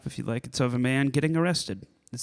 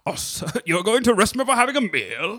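higher, mock-indignant British orator; imitated well-spoken arrestee; 9 semitones higher than usual. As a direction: protest like an outraged thespian. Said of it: "he's so well spoken".

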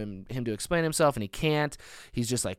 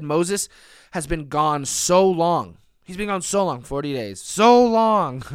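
whiny complaint; 5 semitones higher, 7 dB louder, slower than usual. Retold or imitated. Israelites complaining Moses is gone.

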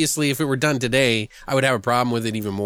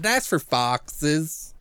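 slow drawled answer; 3 semitones higher, much slower than usual. Retold or imitated. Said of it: "She's like".

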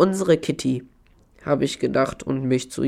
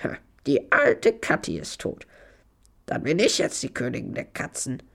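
hushed, breathy, scheming cat; the pet cat gloats that she is now queen of the cats; catty voice; 2 semitones lower, 5 dB quieter than usual. A narrator's reading. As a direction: sneer it softly as a cat crowning herself. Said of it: "mit einer katzigen Stimme gesagt".

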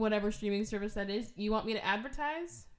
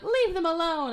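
telling them off; 8 semitones higher, 9 dB louder than usual. Retold or imitated. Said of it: "I'm like".

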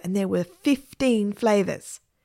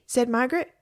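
ordinary delivery, nothing marked

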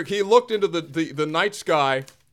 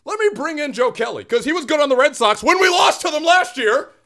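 higher, mock-confident manager; hammed-up manager making a bad call; smug manager choice; Dave Roberts; 7 semitones higher than usual. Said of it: "I'm still Dave Roberts".

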